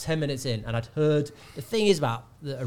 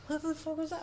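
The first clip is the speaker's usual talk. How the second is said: high, softer disbelieving question; baffled on stage; stunned; 7 dB quieter than usual. Retold or imitated. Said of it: "I was like".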